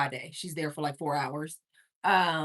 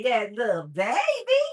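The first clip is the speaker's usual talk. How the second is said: laughing; 5 semitones higher, 6 dB louder than usual. Real, not acted.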